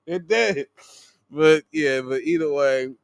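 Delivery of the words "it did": laughing. Real, not acted. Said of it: "that made me laugh".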